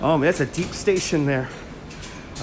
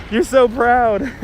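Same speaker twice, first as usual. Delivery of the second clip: mock proud; 6 semitones higher, 6 dB louder, slower than usual. Teasing Norm.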